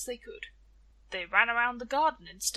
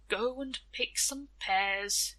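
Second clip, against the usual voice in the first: slow weary Death; slower than usual. Acted. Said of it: "he yielded".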